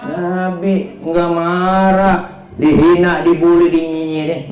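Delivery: high, drawn-out sermon cry; impassioned preaching. Real, not acted. Preacher praising the Prophet who never got angry, fervent preaching. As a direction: ring out each point to the crowd.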